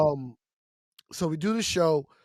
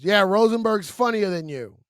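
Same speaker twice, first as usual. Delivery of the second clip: loud, raised mock heckle; imitated heckler yell; 4 semitones higher, 7 dB louder than usual. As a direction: heckle the comic from the crowd. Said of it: "The guy yells out".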